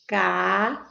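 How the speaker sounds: "Cadeira" drawn out in separate syllables. Shown as a demonstration. Teacher separating syllables on the board, "Cadeira" slowly split up. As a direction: break "Cadeira" into long, spaced syllables. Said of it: "vamos separar a sílaba aqui".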